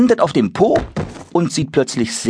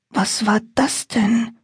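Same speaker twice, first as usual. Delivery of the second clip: verschlafenes Kind; slower than usual. Acted. Verschlafener Moritz fragt.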